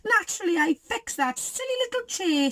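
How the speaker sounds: high-pitched, boastful glee; gleeful Goldie. A narrator's reading. Goldie points out her deeds, gleeful high voice. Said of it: "Goldie gleefully danced".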